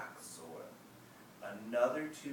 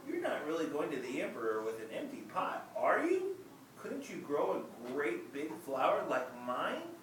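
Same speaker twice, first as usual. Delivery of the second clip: teasing friend; 6 semitones higher, 4 dB louder than usual. Acted. Friend teasing Ping about his empty pot, mildly mocking. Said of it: "he said".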